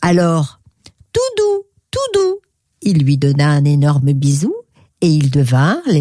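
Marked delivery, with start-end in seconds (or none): 1.1-2.4 very high-pitched little voice; cute storybook squeal; playful character call. Acted. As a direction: chirp it out happily. Happy friends call out before kissing.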